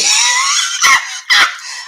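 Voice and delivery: high shrieking burst of laughter. Real, not acted. Host shrieking with laughter at cohost's joke.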